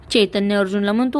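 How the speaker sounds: ordinary delivery, nothing marked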